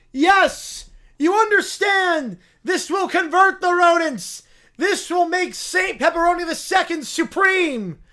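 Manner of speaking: high, loud zealot sermon to the rats; rally the rodents like a mad prophet; preaching bear. Acted. Bear rejoices.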